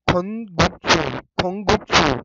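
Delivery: खोंगुकसू drawn out, consonants pressed firmly; slow pressed demo. Shown as a demonstration. Teacher showing students the pressed sound, slow. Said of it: "दबा के बोलना है".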